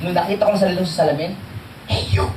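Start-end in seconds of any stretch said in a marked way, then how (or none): 1.9-2.3 hushed. Retold or imitated. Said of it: "Tahimik kasi baka marinig".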